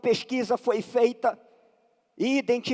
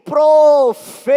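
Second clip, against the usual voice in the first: voice of command; 3 semitones higher, 12 dB louder than usual. Acted. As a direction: thunder it out as an order from God. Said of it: "olha a voz de comando".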